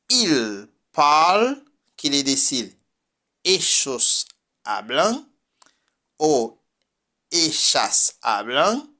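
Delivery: ordinary delivery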